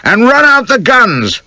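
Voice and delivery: high, slow, loud holler. A narrator's reading. Another spectator cheering the bowler on, hollering. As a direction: bellow it across the ground. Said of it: "hollers another".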